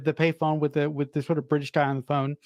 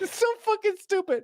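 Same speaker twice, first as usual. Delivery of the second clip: much higher, squeaky mock disbelief; incredulous mock outrage. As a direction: shriek the question in cartoonish disbelief. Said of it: "I'm like".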